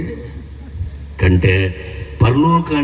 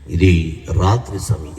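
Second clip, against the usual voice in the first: ordinary talk, nothing marked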